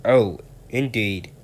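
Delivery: slow, drawn-out displeased voice; surly. Acted. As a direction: let the Fat Controller's annoyance show slowly. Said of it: "said the Fat Controller surlily".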